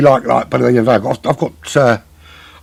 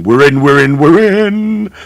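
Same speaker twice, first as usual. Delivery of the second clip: higher, louder gleeful chant of relief; excited relief; 6 semitones higher, 7 dB louder, slower than usual. Real, not acted. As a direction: cheer that the lobby finally loaded. Lobby finally loads.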